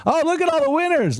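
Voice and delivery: thrilled squeal at the chat; excited. Real, not acted. Host delighted as viewers post wins. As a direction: pitch jumps way up in delight.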